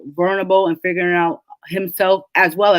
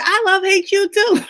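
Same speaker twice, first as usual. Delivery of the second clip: high-pitched mock retort, about to laugh; playful outburst; 12 semitones higher than usual. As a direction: fire it back at the character, tickled. Retelling her outburst at the character.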